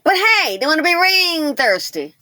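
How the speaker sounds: mocking exclamation. Real, not acted. Mocking women wanting rings.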